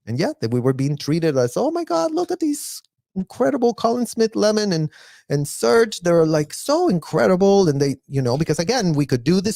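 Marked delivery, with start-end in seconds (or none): 1.6-7.7 much higher, gushing mock praise. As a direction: gush over them like a starstruck fan. People praising the kids.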